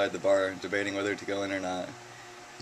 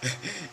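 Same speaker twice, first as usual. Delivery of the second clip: giggle; 10 semitones higher, 5 dB quieter than usual. Real, not acted. Laughs before punchline.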